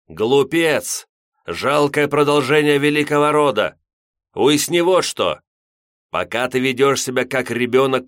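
put-on loud, high furious old priest; furious shout. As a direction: roar at him in rage. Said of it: "облачённое в гримасу ярости".

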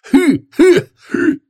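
sharp high gasps for air; gasping, can't get breath; wheezing gasps. A narrator's reading. Undead marshal finds he cannot breathe, gasping. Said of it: "не идёт что-то дыхалка".

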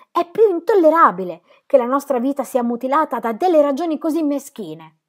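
angry outburst. Acted. Will raging at Dorotea over their fate. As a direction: spit out the unfairness of it. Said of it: "sbottò di nuovo".

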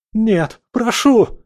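very high, loud, slow shriek; terrified shriek. Acted. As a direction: shriek in terror. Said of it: "взвизгнул парень".

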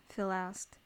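ordinary delivery

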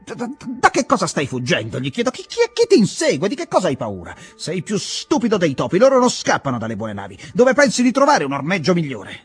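stammering, scolding outburst, climbing high; furious boss berating a deserting clerk; angry tirade. Acted. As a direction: tell him off, words tripping over each other. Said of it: "gliene ho detta di tutti i colori".

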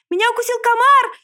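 very high, loud squealed complaint; loud, high-pitched outcry; piglet's scream. A narrator's reading. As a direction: shriek it high like a stung piglet. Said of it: "закричал поросёнок".

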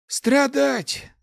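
high, loud, drawn lament; sighed groan. A narrator's reading. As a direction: moan it out theatrically. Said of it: "вздохнул отрёкшийся от стихии маг".

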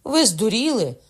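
loud high alarmed shout. A narrator's reading. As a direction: yell him down. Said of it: "кричали". (гучний крик)

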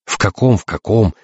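mocking echo, starting high; testy, sarcastic repeat of the question; grumbling. A narrator's reading. Invisible captain mimics the navigator, grumbling. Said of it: "проворчал незримый Альварец".

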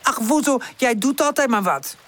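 high, fed-up scolding; exasperated reproach. A narrator's reading. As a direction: scold your friend after sighing. Pip reproaches Woezel in quarrel.

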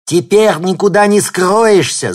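высокий громкий вопль, медленно; орёт малыш, картавя; картавый вопль. A narrator's reading. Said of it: "завопил он, сильно картавя". (мальчишка вопит и картавит)

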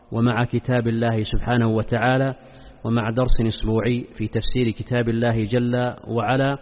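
solemn, measured sermon opening; formal standard arabic. Acted. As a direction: recite the opening praise formally. Formal opening praise formula. (افتتاحية خطبة رسمية هادئة)

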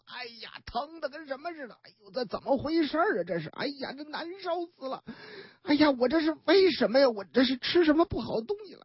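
moaning softly, then fast and fretful; groaning in pain.

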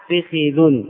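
فخذ as fi-khi-dhun; three-syllable فخذ: fi-KHI-dhun; double kasra. Shown as a demonstration. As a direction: say فخذ with i on both fa and kha. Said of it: "بكسر الفاء إتباعا لحركة".

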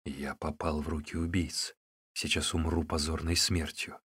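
low, soft thinking to oneself; inner thought. A narrator's reading. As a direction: think it softly, resigned to death. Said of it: "Он подумал про себя".

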